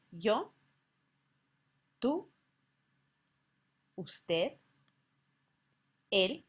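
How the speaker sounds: each Spanish pronoun said slowly and clearly, with a pause after each; Spanish pronouns spoken slowly. Shown as a demonstration. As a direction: model the Spanish pronouns for the learner to repeat. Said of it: "again, just to review".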